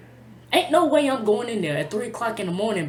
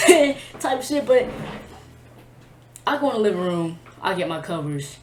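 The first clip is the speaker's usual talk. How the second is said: normal talking voice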